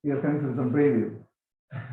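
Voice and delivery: ordinary talk, nothing marked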